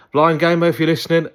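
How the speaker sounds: high, loud hail to a fellow gamer; calling out. Real, not acted. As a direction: call to a friend who may be listening. Calls out to absent friend.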